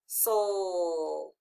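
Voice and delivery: "soul" drawn out, falling; long "soul" sinking in pitch; isolated final syllable. Shown as a demonstration. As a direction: stretch "soul" as one lone syllable. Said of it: "the final stable syllable".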